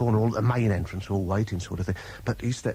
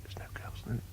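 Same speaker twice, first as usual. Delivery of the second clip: whisper; 15 dB quieter, slower than usual. Retold or imitated. Peeking out, relieved whisper.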